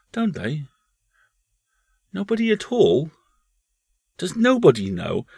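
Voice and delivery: put-on curious little boy, high voice; young boy. A narrator's reading. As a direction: lift the voice into a small boy's. Boy questions his mother.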